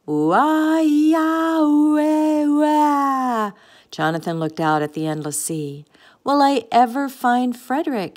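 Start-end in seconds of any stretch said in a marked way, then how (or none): 0.1-3.5 long, high, loud hooting snores; drawn-out cartoon snoring noise; foghorn snore. A narrator's reading. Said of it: "snored like a foghorn and a whistle". 6.2-8.2 higher, louder plaintive question; mildly forlorn wondering. A narrator's reading. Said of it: "he wondered".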